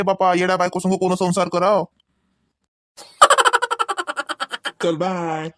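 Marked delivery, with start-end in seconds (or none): 3.2-4.8 high-pitched teasing laugh; husband laughs at his silly wife. Acted. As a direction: burst out laughing at her.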